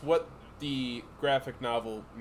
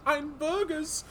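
mock German accent; 13 semitones higher, 6 dB louder than usual. Mouse pleading with the Burgermeister in a high mock German accent.

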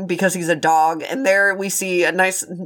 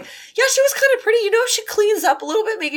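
put-on high, fast dopey boy voice; goofy teen boy; 5 semitones higher than usual. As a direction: gush like a smitten teen. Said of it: "was the only one who was like".

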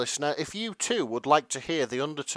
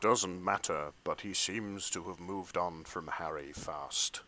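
low character voice; 8 semitones lower, 11 dB quieter than usual. Acted. Reading Ben's lines in the comic.

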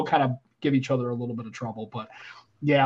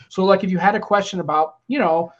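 ordinary talk, nothing marked

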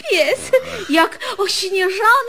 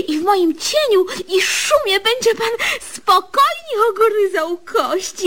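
tearful rapture; 3 semitones higher than usual. Acted. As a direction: gush as if moved to tears. Swooning rapture over the idea.

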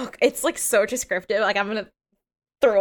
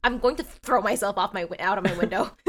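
ordinary talk

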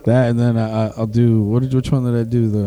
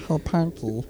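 soft Meatwad impression; 13 dB quieter than usual. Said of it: "impression of the deaf friend".

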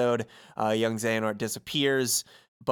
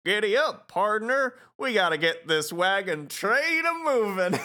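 high, canned cowboy toy catchphrase, much higher; pull-string voice box; 9 semitones higher than usual. Retold or imitated. Woody's voice box gives a high canned cowboy catchphrase. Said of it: "since it's a speak pull string".

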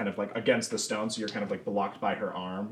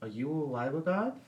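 a touch higher, awed question; lightly voiced awestruck child; little girl amazed by the warrior; wide-eyed girl; 6 semitones higher, 3 dB quieter than usual. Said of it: "her eyes get really wide".

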